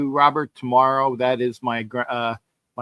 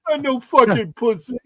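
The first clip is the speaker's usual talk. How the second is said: high protest; 6 semitones higher than usual. Real, not acted. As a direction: drag it out up high. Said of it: "I'm not crying".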